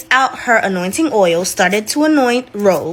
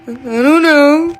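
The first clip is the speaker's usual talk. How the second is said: slow, higher, dumb-sounding I don't know; clueless; 6 semitones higher, 4 dB louder, slower than usual. Retold or imitated. Leon answering Rose's panic, slow and clueless. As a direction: play it dim and blank. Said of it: "looking at her all stupid".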